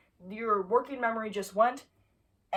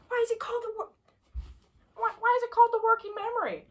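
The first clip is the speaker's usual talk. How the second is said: mock question; 13 semitones higher, 3 dB louder than usual. Teacher voice asking class.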